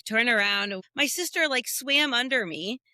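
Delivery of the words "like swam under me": usual speaking voice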